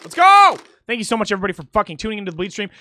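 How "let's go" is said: high, very loud rallying yell; full-voiced excited cheer; hype shout. Real, not acted. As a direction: shout it to kick things off. Hyping up as music starts.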